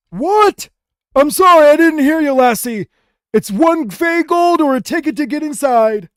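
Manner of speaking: high, loud goblin voice; an old goblin who can't hear a thing; deaf old goblin. Acted. An old goblin who can't hear the guard, high and loud. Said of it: "and then he says".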